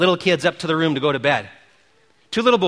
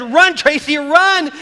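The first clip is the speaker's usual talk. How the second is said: higher, louder cheering shout; crowd screaming; 7 semitones higher, 4 dB louder, slower than usual. Retold or imitated. Everyone at the game screaming for Tracy, cheering shout. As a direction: scream her on toward first base. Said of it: "starts screaming for her".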